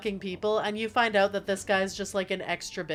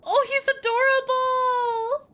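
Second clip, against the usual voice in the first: very high, slow, loud gush; melting with delight, high and drawn out; cute-overload squeal; 5 dB louder, slower than usual. Real, not acted. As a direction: stretch it into a long adoring squeal. Gushing over cute creature.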